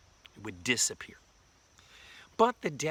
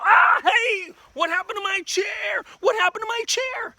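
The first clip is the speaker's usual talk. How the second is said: very high, loud, hammed-up outcry; shocked yelp; 12 dB louder than usual. Tired person whose chair vanished, shocked yelling. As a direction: squawk it in pretend alarm.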